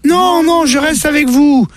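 put-on high, stubborn child voice; little boy voice. Little boy refusing to leave his parents, stubborn and high.

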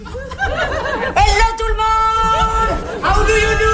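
high, drawn-out sing-song hail; stretched, high-pitched hello; showy greeting. Acted. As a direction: call grandly to a whole crowd. Showy arrival greeting to waiting crowd.

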